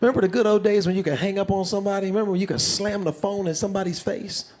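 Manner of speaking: usual speaking voice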